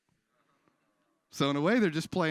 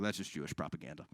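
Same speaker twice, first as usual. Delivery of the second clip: low dismissive denier; 10 semitones lower, 8 dB quieter than usual. Acted.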